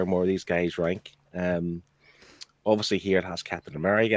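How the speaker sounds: ordinary talk, nothing marked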